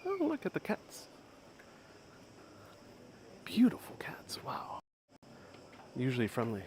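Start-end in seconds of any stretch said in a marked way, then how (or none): 0.1-1.1 much higher, softer cooing at the cats; high soft gush of delight. Real, not acted. Spots cats in the street. 3.4-4.8 hushed whisper of admiration; soft awed whisper. Real, not acted. Admiring cats quietly.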